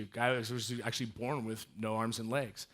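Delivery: ordinary talk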